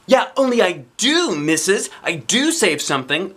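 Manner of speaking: high, loud burst of realization; excited. Acted. Girl to teacher, high loud excited burst. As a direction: jump up thrilled to prove it. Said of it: "real excited".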